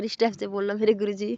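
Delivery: usual speaking voice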